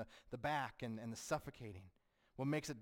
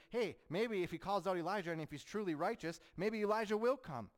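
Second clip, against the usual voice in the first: mocking bystanders; 6 semitones higher, 4 dB louder than usual. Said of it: "they were seeing".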